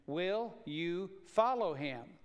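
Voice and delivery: slow down and press the word follow; stress on follow. Shown as a demonstration. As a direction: follow leaned on, slow and drawn out. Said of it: "the emphasis is on follow".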